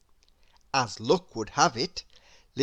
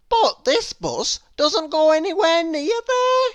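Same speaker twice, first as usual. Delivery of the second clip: nosy Mister Nosey; 12 semitones higher, 8 dB louder than usual. A narrator's reading. Mister Nosey pries at the bus stop, high and nosy. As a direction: lean in, pry with a high sing-song. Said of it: "Nosey replies".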